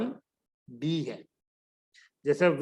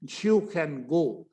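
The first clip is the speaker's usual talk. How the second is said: You can go drawn out very slowly; very slow demo; 2 semitones lower, much slower than usual. Shown as a demonstration. Teacher slowly modelling You can go. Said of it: "आराम से बोलने की कोशिश करेंगे".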